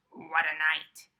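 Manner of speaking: loud gruff mole grumble; mole muttering. A narrator's reading. Mole complaining about the night, a mild mutter. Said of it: "Mole mutters".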